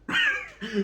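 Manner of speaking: very high squeaky laugh; high cartoon giggle. Laugh in put-on voice.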